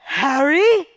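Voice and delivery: high, loud shriek of a name; scream. Acted. Mother with a lizard swept onto her foot, screaming. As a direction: shriek it out, pitch shooting up. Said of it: "and she screams".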